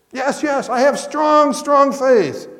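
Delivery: high, loud anxious insistence; eager insisting. Acted. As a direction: swear it desperately to save yourself. Tourist insists to God.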